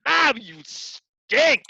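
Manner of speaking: slow, high, loud scornful jeer; high drawn-out mocking put-down; sneering put-down. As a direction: sneer it like a mean instructor. Mock put-down repeated.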